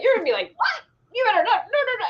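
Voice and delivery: high, panicky no no no; husband mocking his wife's panic. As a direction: squeal in pretend alarm. Husband imitating her panic.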